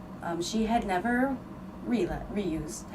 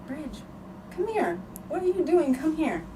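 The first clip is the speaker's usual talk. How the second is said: calling her over; 8 semitones higher than usual. Retold or imitated.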